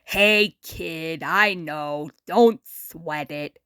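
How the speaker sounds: put-on a worn-out celebrity talking down to a kid; Muddy Mud Skipper.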